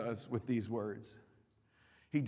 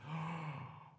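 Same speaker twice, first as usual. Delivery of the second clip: Satan's sneering whisper; 15 dB quieter than usual. Acted. Satan whispering condemnation to the sinner. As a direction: hiss shame into their ear. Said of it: "and then he says".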